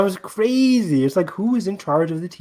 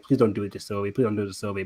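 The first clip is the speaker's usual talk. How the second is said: quiet pleading; 7 semitones lower, 9 dB quieter, much faster than usual. Retold or imitated. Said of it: "I'm like".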